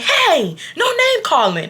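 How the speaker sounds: high-pitched manager's reprimand; drawn-out, high "cut it out" protest; mock scolding. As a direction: imitate an affronted telling-off. Manager scolding two arguing people.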